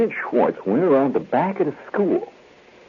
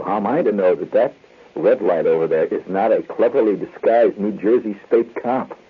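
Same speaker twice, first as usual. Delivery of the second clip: usual speaking voice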